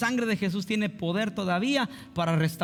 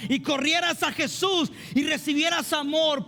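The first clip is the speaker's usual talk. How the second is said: full-force preaching; 10 semitones higher than usual. Acted. Pastor urging the congregation to love Jesus, fervent preaching. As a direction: preach it out with fire, nearly shouting.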